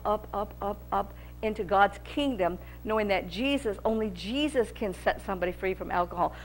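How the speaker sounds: softly, like a confession to the flock; hushed appeal. Real, not acted. Preacher drops to a hushed appeal.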